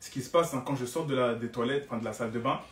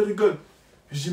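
ordinary talk

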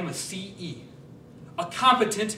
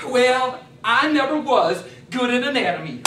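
Cletus the country man; 4 semitones higher, 7 dB louder than usual. Acted. Farm visitor at the door, high loud yokel voice. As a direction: pitch way up and twang it out as the farm visitor. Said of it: "I'm Cletus".

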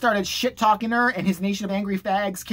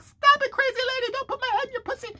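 very high squeaky mock voice; protesting boy. Boy protesting to the crazy lady, squeaky mock panic. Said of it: "He's like".

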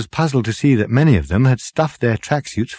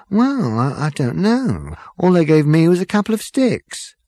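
higher-pitched, faltering excuse; sheepish; 8 semitones higher than usual. A narrator's reading. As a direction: defend yourself sheepishly. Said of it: "said the hairdresser with a sheepish look".

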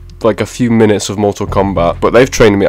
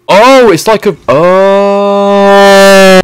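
high, loud squeal of surprise; sudden excited yelp; surprised squeal; 10 semitones higher, 8 dB louder, much slower than usual. Real, not acted. Surprised at beating the tutorial.

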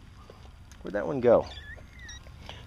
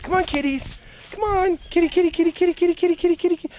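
high kitty call; faster than usual. Real, not acted. Calling kittens to come.

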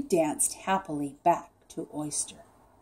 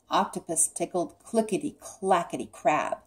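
ordinary delivery

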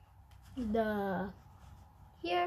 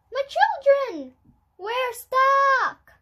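high, loud cry for help; children calling out; 12 semitones higher, 9 dB louder, slower than usual. Acted. Stuck children shouting to their mother for help. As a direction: yell out from being stuck.

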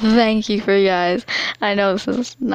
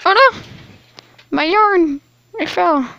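surprised; 8 semitones higher than usual. Real, not acted. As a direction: yelp it as something falls. Yarn suddenly drops.

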